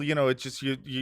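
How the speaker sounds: ordinary delivery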